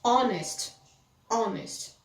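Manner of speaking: "honest" said as "onest", no H; careful model of "honest" with the H dropped; silent H. Shown as a demonstration. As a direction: start "honest" straight on the O. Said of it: "Aqui também".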